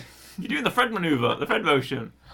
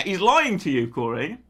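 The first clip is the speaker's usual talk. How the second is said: normal talking voice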